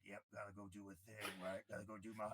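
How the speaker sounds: low, soft, gruff voice. Retold or imitated. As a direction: grunt it out, offhand and gruff. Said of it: "like, gruff and".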